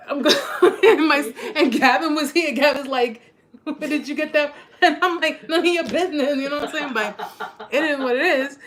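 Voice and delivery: high, loud, laughing retelling; laughing while talking. Real, not acted. Telling viewers about Gavin's question, laughing through the words.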